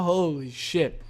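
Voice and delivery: normal talking voice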